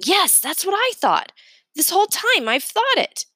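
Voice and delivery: excited outburst. Acted. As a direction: blurt it out, thrilled to be understood. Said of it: "I jump up to say it".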